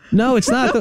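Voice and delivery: put-on a child correcting a muddled dad; high kid voice explaining gently; mock kid answering.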